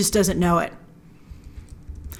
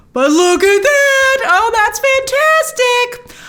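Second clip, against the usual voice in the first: much higher, loud and slow, amazed; thrilled; 13 semitones higher, 4 dB louder, much slower than usual. Real, not acted. Finding Khonshu in a freeze frame, loud and thrilled. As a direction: savor the discovery, delighted.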